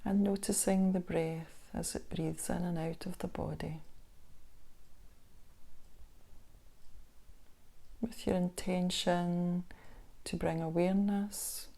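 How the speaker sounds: unhurried body-scan guidance with long pauses; guided meditation. Acted. Instructor guiding listeners through a body scan meditation, calm guided meditation voice. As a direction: ease the listener into stillness, leaving space between phrases.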